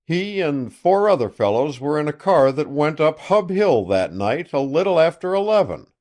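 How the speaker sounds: loud, boastful country sheriff on the phone; a gloating sheriff calling an old friend; triumphant sheriff. Acted. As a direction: crow over the news like a self-satisfied lawman.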